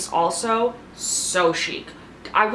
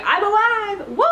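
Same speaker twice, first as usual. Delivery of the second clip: triumphant cheer; 13 semitones higher, 4 dB louder than usual. Retold or imitated. Imitating a player returning to breakfast, whooping in triumph. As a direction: sing out a jubilant arrival, way up high. Voicing Lisa's arrival at breakfast.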